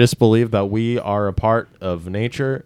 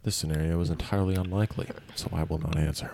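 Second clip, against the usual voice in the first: quiet deadpan robot; 5 semitones lower, 13 dB quieter than usual. Pretend. Mock robot answer.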